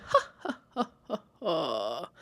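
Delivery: spaced-out "ha"s sliding down in pitch; imitated jolly old laugh. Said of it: "Like a jolly old laugh".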